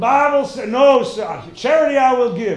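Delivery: loud Texan Bible-believer; Texan farmer accent. Acted. Farmer insisting he gives charity, loud Texan accent. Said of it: "if you're gonna talk with that accent".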